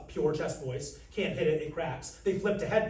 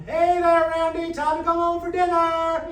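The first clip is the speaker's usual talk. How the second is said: high, loud, sustained parent's call; ringing call-out on a held high pitch; 12 semitones higher, 10 dB louder than usual. Shown as a demonstration. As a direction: call a child home from down the street. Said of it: "That's a call".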